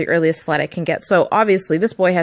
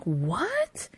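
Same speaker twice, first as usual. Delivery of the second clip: high, slow stunned what; shocked disbelief; 12 semitones higher, much slower than usual. Retold or imitated. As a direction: imitate her own outraged shock. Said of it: "And I was like".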